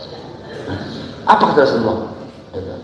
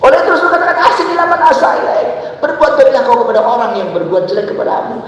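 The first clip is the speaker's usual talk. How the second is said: preaching at full force; 11 semitones higher than usual. Acted. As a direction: call it out to the whole congregation. Raised preaching. (khotbah berapi-api dengan suara lantang)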